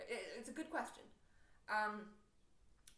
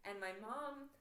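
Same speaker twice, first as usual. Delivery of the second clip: usual speaking voice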